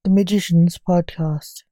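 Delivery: loud, showy host intro; podcast announcer. Acted.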